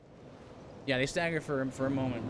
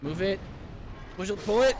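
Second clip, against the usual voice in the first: much louder and higher, pushing; urgent urging; 9 semitones higher, 7 dB louder than usual. Real, not acted. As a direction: urge it on in the clutch. Urging in tense game moment.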